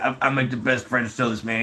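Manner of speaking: lightly mimicked friend's voice; imitating his buddy. Buddy bragging about his French toast, lightly imitated voice. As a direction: put on a hint of the buddy's voice. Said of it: "that's what his voice sounds like".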